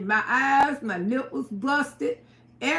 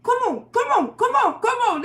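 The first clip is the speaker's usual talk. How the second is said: urgent urging; 9 semitones higher, 3 dB louder than usual. Retold or imitated. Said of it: "they were finna F him up".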